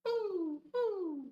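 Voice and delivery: very high, loud hoots; owl hoot. Acted. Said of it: "He can sound like an owl".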